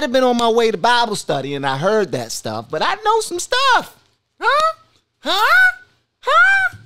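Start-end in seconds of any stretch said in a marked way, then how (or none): 2.8-3.9 high squeal. Acted. Rising boast. 4.4-6.8 very high, loud squeaky huh; mock taunt, high and loud.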